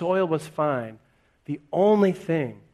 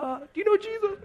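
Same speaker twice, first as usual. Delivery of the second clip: squeaky high, rushed nervous pitch; tiny panicked voice asking about Jesus; anxious evangelist; faster than usual. Retold or imitated. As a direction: blurt it out like a jittery witness. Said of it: "these really anxious conversations".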